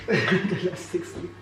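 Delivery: quiet, slow, laughing repeat; gentle amused echo; soft laughing echo. Real, not acted. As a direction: echo it softly through a laugh.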